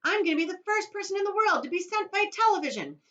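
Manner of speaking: put-on high, loud boy's voice; boy bragging as he dashes off; excited boast. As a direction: crow it out triumphantly. Boy running to camera.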